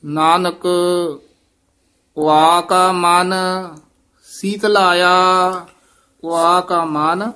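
slow, measured scripture chanting; slow recitation. Shown as a demonstration. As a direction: recite each phrase slowly and deliberately.

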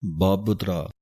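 ਬਾਬਤਰਾ with an u sound after the first b, babutra; ਬਾਬਤਰਾ ਔਂਕੜ ਨਾਲ; careful pronunciation. Shown as a demonstration. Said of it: "ਬੱਬੇ ਦੇ ਥੱਲੇ ਔਂਕੜ ਦੀ ਆਵਾਜ਼".

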